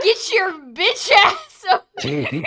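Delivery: loud barked command, high and laughing; mock drill sergeant shout. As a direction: yell it like a drill sergeant, hamming it up. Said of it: "So aggressive".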